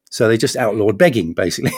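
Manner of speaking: ordinary delivery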